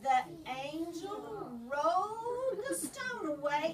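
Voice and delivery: high, loud, slow build; excited reveal. Acted. Teacher building excitement at the resurrection, loud and high. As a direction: make the angel's moment thrilling. Climax of story for children.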